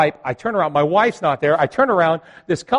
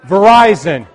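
mock ad slogan; 5 semitones higher, 12 dB louder than usual. Mocking the phone company slogan.

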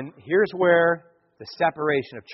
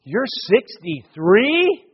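slow, rising incredulous question; parents' disbelief; 4 semitones higher, much slower than usual. Retold or imitated. Parents doubting the speaker's age, drawn-out disbelief. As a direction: stretch it out into an astonished question. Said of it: "And they go".

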